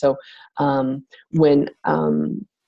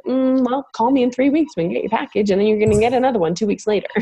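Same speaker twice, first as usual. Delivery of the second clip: dismissive; 7 semitones higher than usual. Retold or imitated. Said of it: "Don't be like".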